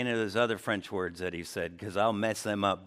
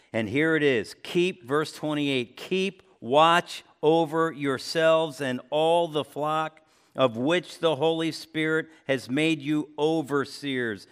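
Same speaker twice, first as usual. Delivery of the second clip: higher, louder, slow and deliberate preaching; 4 semitones higher, 5 dB louder than usual. Acted. Pastor reads Paul's charge to the elders, slow preaching. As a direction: drive home the charge, word by word.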